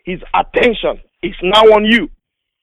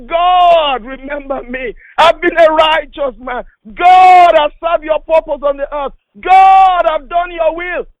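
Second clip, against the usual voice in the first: desperate crying prayer; 9 semitones higher, slower than usual. Acted. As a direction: cry out to God in anguish. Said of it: "started crying, weeping".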